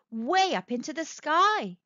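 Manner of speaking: plain reading voice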